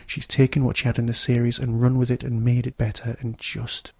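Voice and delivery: ordinary talk, nothing marked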